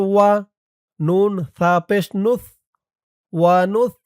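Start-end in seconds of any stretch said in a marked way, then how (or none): none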